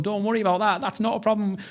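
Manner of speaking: breezy, upbeat reassurance to the wife when things go wrong. Retold or imitated. Cheering up wife at home.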